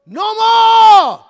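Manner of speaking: shouted sermon over music; full-force preaching. Acted. Pastor preaches to the church, shouting over the music. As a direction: call it down in Jesus' name.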